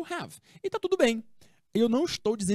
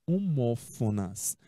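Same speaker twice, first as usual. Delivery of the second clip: slow model word; 8 semitones lower, slower than usual. Shown as a demonstration. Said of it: "teacher models the word".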